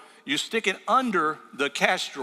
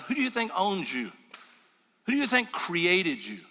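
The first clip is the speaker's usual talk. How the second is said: normal talking voice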